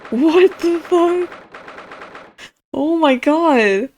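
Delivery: loud, drawn-out disbelief; stunned, slow exclamation; real shock. Real, not acted. Startled reaction to guillotine clock.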